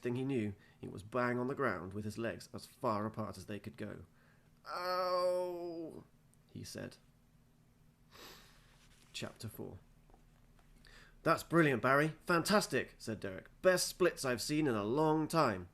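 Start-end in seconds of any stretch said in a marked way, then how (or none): 4.7-6.0 a high, sudden yelp of pain; mock-pained high ow. Lands in the splits. 11.2-15.7 higher, louder gushing coach; over-eager coach. Acted. Coach Derek praises Barry.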